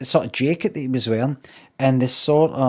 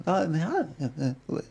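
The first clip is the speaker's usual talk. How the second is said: soft mumbled mimicry, trailing into wordless uhs; imitated mumbling; 3 semitones higher, 9 dB quieter than usual. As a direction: mumble it faintly, imitating his hemming and hawing. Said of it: "he's kind of saying, like".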